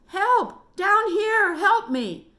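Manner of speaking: high, loud desperate scream; screaming for help. Acted. Trapped boy screams up the cave for help. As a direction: scream with everything you have. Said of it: "screamed Derek as loud as he could".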